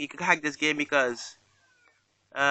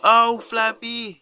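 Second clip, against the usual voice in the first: loud, slow and stretched, higher; excited cry; 6 semitones higher, 9 dB louder, much slower than usual. Real, not acted. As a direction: cheer the bird on, thrilled. Big score in the game.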